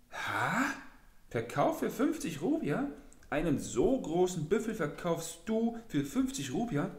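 can't believe the price, voice jumps up; agitated disbelief. Acted. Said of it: "jetzt erregt".

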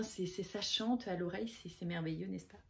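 very soft, low admiring aside; hushed admiration. Real, not acted. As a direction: let the voice drop away in wonder. Hushed admiring aside about Italian.

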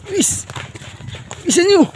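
high, loud urgent call; urgent shout. Real, not acted. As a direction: hurry them, shouting. Urging kids to run.

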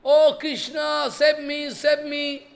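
high, slow cry to Krishna for rescue; a hammed-up frightened plea to be saved; crying for help. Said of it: "And Nanda Maharaj crying".

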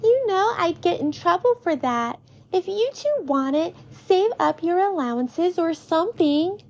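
put-on high-pitched girl's voice; child saying no to friends, pitched high; Beth, refusing. A narrator's reading. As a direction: brush off the request in a high kid voice. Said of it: "Beth leans in".

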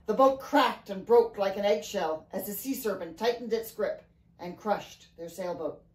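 plain reading voice

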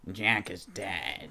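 low, slow, drawn-out character voice; Revengeance voice. Retold or imitated. Asking how his old game voice would feel, slow low imitation. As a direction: stretch it out in a low mock-tough voice. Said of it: "like, his Revengeance voice".

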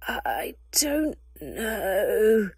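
very slow, cracked, tearful whisper; tortured girl rasping to her captor; rasping and broken. Acted. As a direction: barely get the words out through pain. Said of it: "Her voice was rasping and broken".

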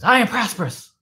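high, loud imitation of a child; mock kid's shout; daughter echoing loudly. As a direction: yell it back like an eager little girl. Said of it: "And she goes".

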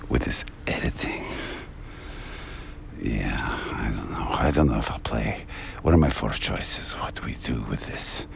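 steady whisper through the rest; breath only, no voice. Real, not acted. Whispering over a hater's comment about Michael Owen.

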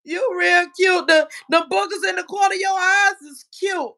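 high, sarcastic fake compliment; mock flattery. Mocking dishonest viewers, high sarcastic flattery. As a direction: lay the fake praise on thick. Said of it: "Don't be all that".

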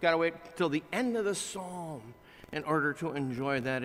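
ordinary delivery

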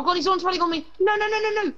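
high, frantic shouts of no; panicked yelling. Real, not acted. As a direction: scream at the car to drive away. Being chased, yelling to teammate.

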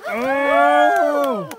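loud startled cry into laughter; surprised laugh. Real, not acted. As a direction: gasp then laugh hard. Friend slips, laughing.

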